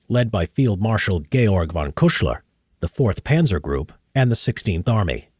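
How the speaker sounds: measured history narrator read; documentary narration. Acted. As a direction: read it like a war documentary voice-over.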